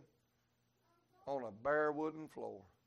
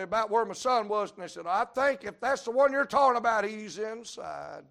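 louder, higher, building to the reveal; rising intensity; 7 semitones higher, 11 dB louder than usual. Retold or imitated. Preacher retelling the father's search, building louder. Retold tense crack-house search.

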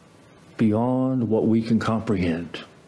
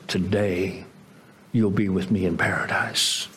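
tender promise; 5 semitones lower than usual. Retold or imitated.

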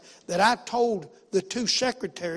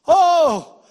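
high, full-voiced shout of relief; exultant imitated holler; retold joyful cry; 9 semitones higher, 15 dB louder than usual. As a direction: re-enact a man crying out in joy. Retold joyful release after prayer.

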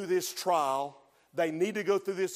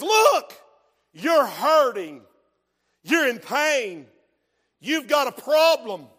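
loud, high, stretched-out taunt; preacher voicing an insistent tempter; devil's taunting; 7 semitones higher, 11 dB louder, slower than usual. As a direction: press each grievance home loudly.

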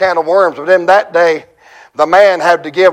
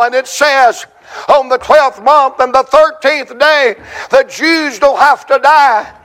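high, ringing pulpit proclamation; full-force preaching; 10 semitones higher, 3 dB louder than usual. Acted.

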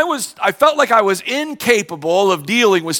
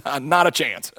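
low, soft, flat refusal; deadpan the judge's no; dry judge; 6 semitones lower, 6 dB quieter than usual. Retold or imitated. Judge dismissing the pastor's plea, low and dry. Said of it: "And the guy says".

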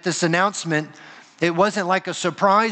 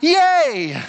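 a short mock "Yay!" cheer, pitched up and louder; 8 semitones higher, 4 dB louder than usual. Preacher mocking a party cheer the shepherds never gave. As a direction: cheer like a surprise party guest, hammed-up. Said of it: "shepherds ... aren't going".

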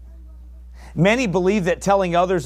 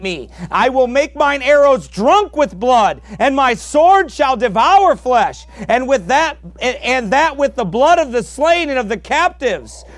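thundering scripture reading; 8 semitones higher, 6 dB louder than usual. Acted. Preacher reading God's threats to Israel at full force. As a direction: boom out God's vow of vengeance. Reads God's words in Deuteronomy.

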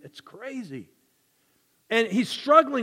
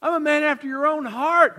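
David's declaration; 6 semitones higher, 3 dB louder than usual. Retold or imitated. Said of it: "That's David".

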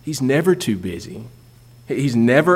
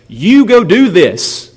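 louder, higher, slow commanding voice; emphatic command; 6 semitones higher, 6 dB louder, slower than usual. Retold or imitated. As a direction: order them firmly, word by word. Jesus commands his disciples.

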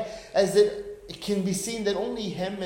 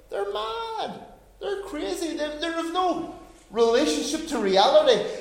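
high mocking; 10 semitones higher, 3 dB louder than usual. Preacher mimics scoffers, high.

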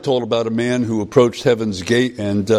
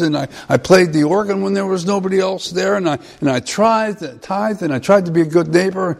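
much higher, eager pleading voice; anxious man; 8 semitones higher than usual. Retold or imitated. Man at heaven's gate pleads with the angel, high and eager. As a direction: list your merits anxiously. Man listing his deeds.

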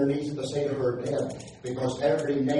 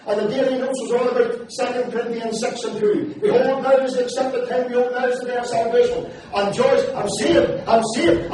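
excited retelling; 6 semitones higher, 3 dB louder than usual. Retold or imitated. Retelling Scott's joyful call.

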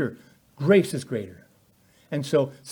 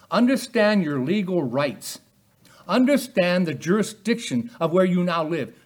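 rising, louder preaching voice; 5 semitones higher, 4 dB louder than usual. Acted. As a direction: preach it with mounting fire. Preaching at full force.

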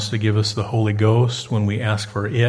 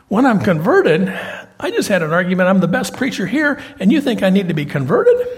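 high, louder mock indignation; indignant protest; 6 semitones higher, 4 dB louder than usual. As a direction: protest like an affronted Peter, pitch climbing. Acts out Peter's offended thought.

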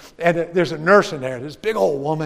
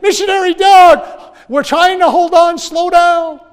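slow, much higher loud shout; yelling; 12 semitones higher, 10 dB louder, slower than usual. Retold or imitated. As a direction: bellow from the truck bed to slow down. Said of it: "They're like".

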